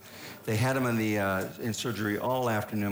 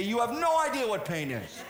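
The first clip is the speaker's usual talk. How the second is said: high mock tough-dad lecture, laughing through it; gruff dad impression; 11 semitones higher than usual. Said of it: "he'd say".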